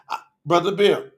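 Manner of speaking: usual speaking voice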